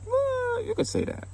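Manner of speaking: squeaky falsetto, sly and evasive; coy high voice. Retold or imitated. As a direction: pipe it up high like a guilty dodge. Sly answer about ghosting her.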